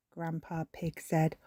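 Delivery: ordinary delivery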